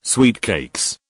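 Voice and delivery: a touch of disgust on the question. Acted. Serena throwing back his pet name, mild disgust. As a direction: repeat the name back, repelled. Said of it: "Serena questioned with disgust".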